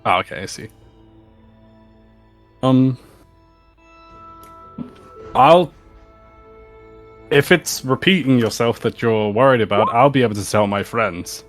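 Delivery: ordinary talk, nothing marked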